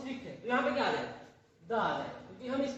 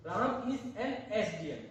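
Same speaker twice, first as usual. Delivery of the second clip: slow dictation; much slower than usual. Shown as a demonstration. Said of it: "तो यहाँ पे क्या आ जाएगा?".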